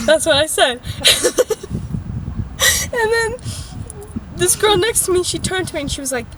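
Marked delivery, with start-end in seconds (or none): 0.8-2.9 breathy burst of laughter; laughing. Real, not acted. Storyteller amused by the fly story, laughing. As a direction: crack up at your own act.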